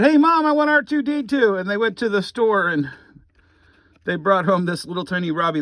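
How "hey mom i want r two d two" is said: put-on higher, louder eager kid asking Mom; imitating a kid. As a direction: beg like a child wanting a toy, lightly played. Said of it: "When you said".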